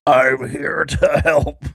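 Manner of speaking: slow, raspy put-on voice; slow mock politician voice; RFK Junior. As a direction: drag it out like a weary savior. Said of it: "It's R F K Junior".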